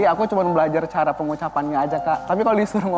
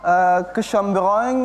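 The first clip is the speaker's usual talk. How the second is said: French accent; 3 semitones higher, slower than usual. Shown as a demonstration. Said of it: "disebutkan dengan logat bahasa Prancis".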